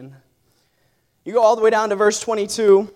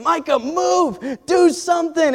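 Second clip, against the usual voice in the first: higher, slow shout of Micah, move; coach yelling; 7 semitones higher, slower than usual. Retold or imitated. Coach shouting from the sideline at his exhausted fighter. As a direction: call across the mat to a frozen fighter. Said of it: "hearing my coach on the sideline saying".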